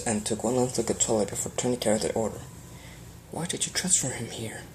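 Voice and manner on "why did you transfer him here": unvoiced hushed question; urgent whisper. A narrator's reading. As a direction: whisper it so nobody hears.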